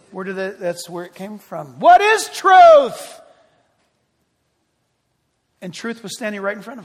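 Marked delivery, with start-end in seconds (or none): 1.8-3.2 high, very loud, drawn-out cry; booming rhetorical outcry as Pilate; shouted question. Acted. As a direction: shout it slowly, every word stretched. Pilate's question repeated at full force.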